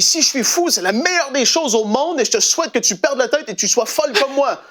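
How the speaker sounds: high, exuberant declaration; saved son answers his mother, exuberant; fervent high exclamation. Retold or imitated. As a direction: proclaim it high with joyful fervour. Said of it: "Il dit".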